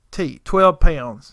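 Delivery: ordinary talk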